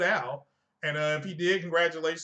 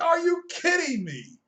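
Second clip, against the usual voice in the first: exaggerated outraged disbelief, pitched way up; mock disbelief; 11 semitones higher, 4 dB louder than usual. Streamer mocking viewers who ignore the disclaimer, high mock outrage. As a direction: squeal it like a scandalised fan. Mocking a fan's reaction again.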